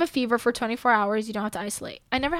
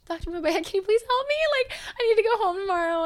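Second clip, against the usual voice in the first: high, fast, tearful mock plea; desperate pleading; 8 semitones higher, faster than usual. Patient begging doctor to help her home, desperate pleading. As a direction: beg for help, whimpering a little.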